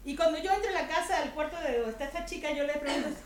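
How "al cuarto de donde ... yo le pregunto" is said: ordinary talk, nothing marked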